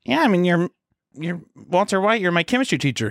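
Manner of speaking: put-on high, gushing teen girl voice; smitten schoolgirl. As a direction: gush about her boyfriend. Student answering eagerly.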